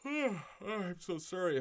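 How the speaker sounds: slow yawn through the words. Real, not acted. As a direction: talk mid-yawn. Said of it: "I'm yawning".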